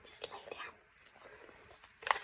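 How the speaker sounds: slow, hushed whisper telling the dog to settle; slow soothing whisper to a restless pet. Real, not acted. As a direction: shush the dog without breaking the lesson. Hushing the dog mid-lesson.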